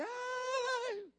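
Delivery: high, thin imitated wail of a weeping woman; hammed-up tearful sigh; mock crying wail. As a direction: squeak out a crying lady's ay. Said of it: "entonces que dice".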